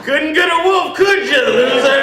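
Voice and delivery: high, laughing mock taunt; taunting a pit bull owner, high and mocking. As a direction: sneer it up high through a laugh.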